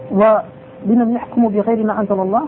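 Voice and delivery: usual speaking voice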